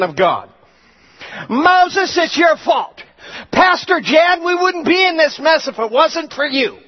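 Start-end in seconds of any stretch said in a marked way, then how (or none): 1.5-6.8 high, loud blaming yell; accusing shout. Acted. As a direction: blame Moses at the top of your voice. People blame Moses.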